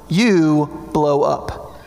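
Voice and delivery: you blow up, stretched very slowly; slowed punch. Real, not acted. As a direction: drag out each word for weight. Slow punchline of the point.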